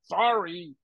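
high, hurried dismissal; imitating someone giving up on you; retold brush-off. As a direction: wave it off as the impatient producer. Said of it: "he goes".

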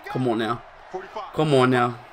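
ordinary talk, nothing marked

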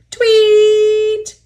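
long, very high, loud tweet; shrieking imitation of a conductor's whistle; warning whistle. A narrator's reading. Conductor warns Duck on the hill, piercing whistle. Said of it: "a conductor's warning whistle".

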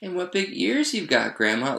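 put-on high child's voice, dropping at the end; wondering little girl. A narrator's reading. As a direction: marvel like a small girl. Girl marvels at Grandma's ears.

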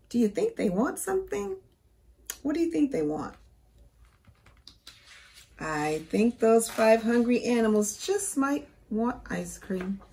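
normal talking voice

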